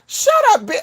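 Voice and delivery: very high, sudden mock outburst; involuntary blurt. Rude thought escaping before correction, involuntary blurt. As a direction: let it burst out by accident. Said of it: "kinda like Tourette's".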